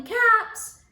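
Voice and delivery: high street cry; calling out wares. Acted. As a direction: hawk caps to an empty street. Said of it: "he walked down the street calling".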